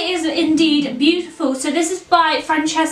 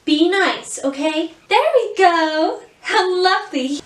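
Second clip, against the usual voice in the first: put-on higher, lilting princess voice in an American accent; Rapunzel; 4 semitones higher than usual. Introducing herself to a child at the door, in an American princess accent. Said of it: "permitted to do an American accent".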